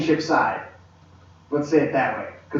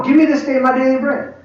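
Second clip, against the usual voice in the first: higher-pitched, confident demand; bold prayer; 7 semitones higher than usual. Acted. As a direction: claim it boldly, no tiptoeing. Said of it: "come boldly".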